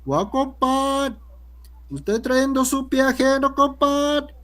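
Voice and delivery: put-on high, slow sing-song Piura accent. Teacher imitating a Piura man greeting his compadre. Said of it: "el piurano de esa zona dice".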